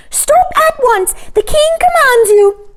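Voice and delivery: very high, loud courtier chorus; shrill echo of the king; courtiers crying. A narrator's reading. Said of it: "cried all the courtiers".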